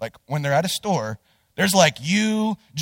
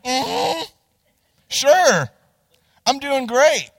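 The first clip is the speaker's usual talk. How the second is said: higher, forced-cheery sarcasm; sarcastic reply; 8 semitones higher, 5 dB louder, much slower than usual. Retold or imitated. After getting rammed, he tells the lady he's fine, sarcastic. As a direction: pretend all is fine through clenched politeness.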